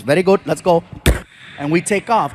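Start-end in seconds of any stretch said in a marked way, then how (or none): 0.0-0.8 calm, low Indian cabbie voice; Indian accent. Retold or imitated. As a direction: agree breezily as the driver. Driver agrees.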